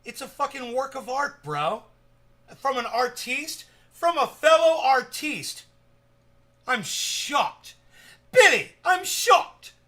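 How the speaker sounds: high, loud, drawn-out indignation; mock outrage. Streamer defending his song to Billy, theatrically appalled. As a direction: wail in hammed-up offense.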